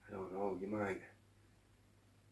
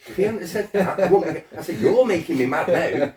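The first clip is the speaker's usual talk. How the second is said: loud, high, heated shouting with a laugh breaking through; angry shouting; 8 semitones higher, 17 dB louder than usual. Retold or imitated. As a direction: relive the row, half laughing as you yell. Retold argument with anger counsellor.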